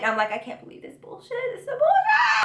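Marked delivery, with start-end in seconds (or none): 0.3-1.3 hissed under the breath; whispered fuming. Retold or imitated. Throwing a fit about the boyfriend, whispered. 1.5-2.4 shrieking laugh-cry; throwing a fit. Real, not acted. Throwing a fit over the ruined weekend, shrieking. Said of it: "throwing a fit".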